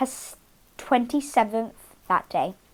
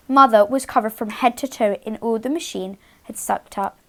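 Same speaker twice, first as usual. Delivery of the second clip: usual speaking voice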